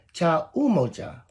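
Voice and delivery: usual speaking voice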